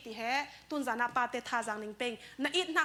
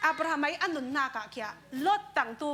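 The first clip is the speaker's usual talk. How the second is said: normal talking voice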